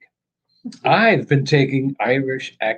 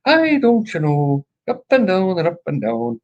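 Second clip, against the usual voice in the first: put-on sing-song Irish accent; 4 semitones higher than usual. Showing viewers the Irish sing-song, hammed-up Irish accent. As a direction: swoop up and down like a stage Irishman. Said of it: "kind of a sing-song".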